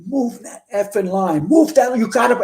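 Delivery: high, drawn-out urgent order; retold screaming. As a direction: re-enact yelling a command without full volume. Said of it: "I'm screaming at them".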